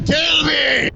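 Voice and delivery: high, hammed-up shout; theatrical shouted plea; mock dramatic cry. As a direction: cry out to the heavens. Cold rider cries to heaven.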